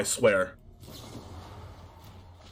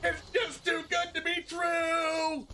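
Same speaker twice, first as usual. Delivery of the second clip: can't believe their luck, half-laughing; incredulous laughing; 9 semitones higher, 6 dB quieter, slower than usual. Real, not acted. Unbelievable game luck.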